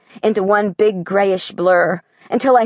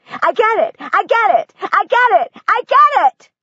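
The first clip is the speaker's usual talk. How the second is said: much higher, building to a loud shout; ecstatic shout; 12 semitones higher than usual. Acted. Girl shouting her breakthrough to her dead brother, building ecstatic shout. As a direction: cry it to heaven, louder each time. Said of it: "I shout loud enough for Devon to hear".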